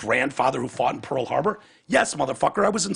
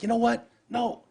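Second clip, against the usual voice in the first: much higher, bright turn; sudden realization; 10 semitones higher, 3 dB louder than usual. Retold or imitated. Catching himself mid-rant about a friend, much higher. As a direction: snap out of it, higher. Retold change of mind.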